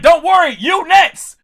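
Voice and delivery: high, loud call to your daughter; retold teasing warning. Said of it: "I'm like".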